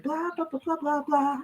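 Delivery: high, soft mocking blah-blah; mocking viewers' chatter. Vlogger mocks viewers nagging her, high soft teasing. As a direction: tease the impatient viewers, high and light. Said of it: "Everybody's like".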